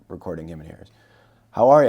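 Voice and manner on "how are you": loud, high, booming hello; hearty greeting. Retold or imitated. Kais arrives at the table to meet him, hearty. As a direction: burst in with a big greeting.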